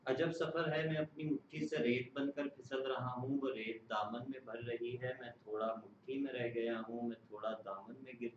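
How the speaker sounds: measured, solemn poem reading; stately nazm recitation. Acted.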